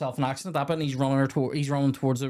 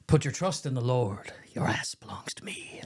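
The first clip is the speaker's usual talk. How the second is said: menacing film quote; 3 dB quieter than usual. Acted. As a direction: voice sinking into a whisper. Quoting the warden's menacing line.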